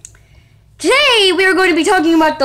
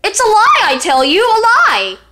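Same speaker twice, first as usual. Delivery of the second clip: higher, hammed-up outraged cry; indignant exclaiming; 6 semitones higher, 3 dB louder than usual. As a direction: proclaim it like an exposed conspiracy. Ranting about a fake story.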